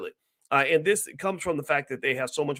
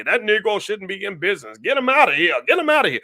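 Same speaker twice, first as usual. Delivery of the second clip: rising, louder and higher into a scornful yell; mocking angry naysayer; 6 semitones higher, 5 dB louder than usual. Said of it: "there's some people who say".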